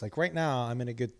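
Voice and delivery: ordinary talk, nothing marked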